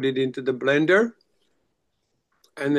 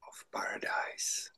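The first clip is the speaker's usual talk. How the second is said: whisper; 15 dB quieter than usual. Acted. Hushed spiritual reading.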